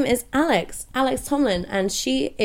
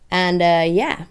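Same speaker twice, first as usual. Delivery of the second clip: ordinary delivery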